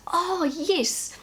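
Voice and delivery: high "oh" of discovery, easing down; delighted realisation. Retold or imitated. Child shown how to draw by Dad, pleased realisation. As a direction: act out a child suddenly getting it.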